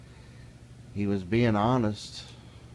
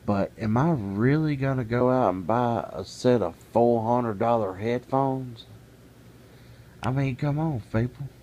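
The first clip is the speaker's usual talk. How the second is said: loud, higher, disbelieving outburst; exasperated rant; 4 semitones higher, 5 dB louder than usual. Real, not acted. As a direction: scoff at the silliness of it, loudly. Ranting about costly headphones.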